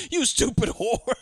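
put-on high, slow drunken insult; drunken bully. Drunk douchebag insults the woman, drunken bully. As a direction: draw it out like a belligerent drunk. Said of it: "This guy is so drunk".

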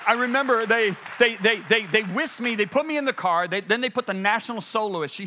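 ordinary talk, nothing marked

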